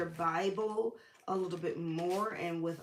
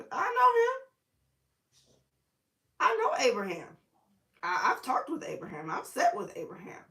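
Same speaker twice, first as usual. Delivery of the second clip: voicing Jesus; 6 semitones higher, 5 dB louder than usual. Jesus voiced in retelling.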